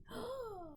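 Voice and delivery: a sharp playful gasp, as if scandalised; mock shocked gasp. Co-host hears a shocking question, mock gasp. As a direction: gasp quietly, pretending to be shocked.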